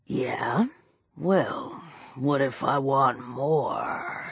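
deep, hushed, slow menace; soft predatory drawl; drawn-out words. A narrator's reading. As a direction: draw the last word out, low and threatening. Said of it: "He drew the word out".

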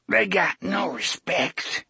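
put-on thick, slurred, high old drunk; drunk old man. A narrator's reading. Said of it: "the old bastard said thickly".

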